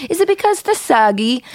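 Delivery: squeaky put-on kid voice with a phony French lilt; kid's silly fake French voice. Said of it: "that stupid voice the kids do".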